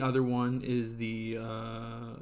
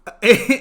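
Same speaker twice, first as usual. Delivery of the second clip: burst of laughter; 11 semitones higher, 16 dB louder than usual. Real, not acted. Laughing at sponsor joke.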